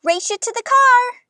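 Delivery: high, loud eager call; excited dare. Acted. Amelia Bedelia calls excitedly to Granddad. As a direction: challenge him with a rush of glee.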